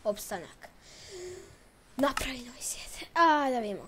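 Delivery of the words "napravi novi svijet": unvoiced whisper. Shown as a demonstration. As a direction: breathe the words, no voice. Whispered repeat of line.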